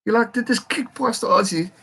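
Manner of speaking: high-pitched, incredulous reaction; amazed disbelief. Real, not acted. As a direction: marvel at it in a high, excited voice. Live disbelief at the try.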